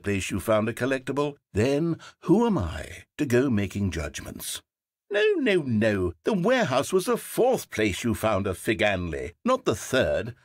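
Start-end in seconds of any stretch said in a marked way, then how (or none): 5.1-6.1 much higher, near-shrieking no; fed-up correction; exasperated protest. Acted. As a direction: burst out at the repeated error. Narrator corrects again.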